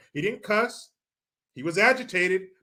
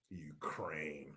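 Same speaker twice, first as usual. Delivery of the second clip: whisper; 23 dB quieter than usual. Real, not acted. Whispered aside.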